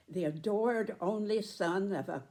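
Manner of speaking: usual speaking voice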